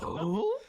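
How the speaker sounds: loud, high rising "oh?" grunt; big comic puzzled hoot; questioning grunt impression. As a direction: imitate a TV catchphrase grunt. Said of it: "It's actually a pretty good impression".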